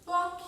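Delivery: high, sudden "ploc"; bubble pop sound. Retold or imitated. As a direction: imitate a soap bubble popping. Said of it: "depois a bolinha faz".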